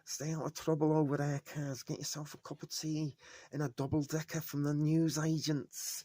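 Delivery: lightly put-on Northern English accent, sending a viewer off. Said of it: "shall I put on my northern accent".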